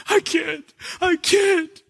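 put-on high, pleading kid's voice; a hurt boy saying no; whiny little boy. Son refusing his dad, whiny little boy.

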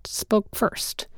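ordinary delivery, nothing marked

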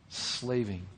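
Slaving said soft and low, falling; quiet. Real, not acted. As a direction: let Slaving sink quietly.

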